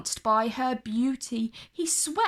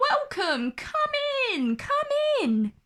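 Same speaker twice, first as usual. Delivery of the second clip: much higher, bustling welcome; cheery dwarfs. Acted. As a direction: wave her in eagerly. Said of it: "they chorused".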